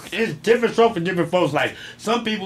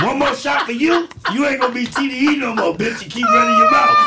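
higher, louder hype shout; yelling; 6 semitones higher, 4 dB louder than usual. Real, not acted. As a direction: shout it across the room, rising. Hyped yelling at a friend.

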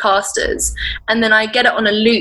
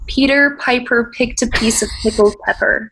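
rhotic American R's; American accent warm-up line; 2 semitones higher than usual. Shown as a demonstration. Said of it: "So I always do".

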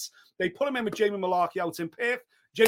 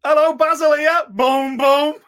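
loud, high, jokey catchphrase delivery; booming Basil phone greeting; 7 semitones higher, 13 dB louder, slower than usual. Acted. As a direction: belt it out as a cheery comic character. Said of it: "I'll be Basil".

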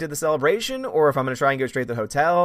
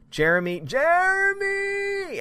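mock call-out; much slower than usual. Calling out to rival streamer.

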